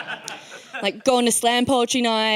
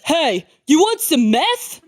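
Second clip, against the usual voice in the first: mock dealer; 3 semitones higher, slower than usual. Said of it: "he's like".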